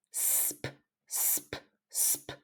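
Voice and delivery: voiceless "sp" breathed out softly; whispered blend. Shown as a demonstration. Teacher whispering the blend for students to copy. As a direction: hiss "sp" with no voice at all. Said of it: "Vamos a hacer esta".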